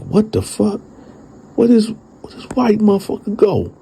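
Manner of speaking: high, bewildered outcry; high baffled what-the. Retold or imitated. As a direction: squeak it out like you can't believe your eyes. Baffled, the man vanished.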